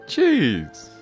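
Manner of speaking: normal talking voice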